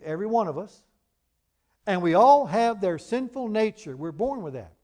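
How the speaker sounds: loud, ringing pulpit preaching; preaching at full force. Acted. Preacher builds on Adam and Eve.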